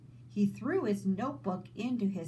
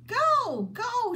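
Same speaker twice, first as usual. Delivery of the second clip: high, much louder shouted go, go; urgent shout; 8 dB louder than usual. Acted. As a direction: shoo him off loudly and impatiently. Sister urging brother away.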